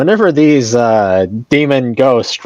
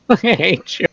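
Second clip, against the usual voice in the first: laughing; 7 semitones higher, 4 dB quieter than usual. Pretend. Repeated through laughter.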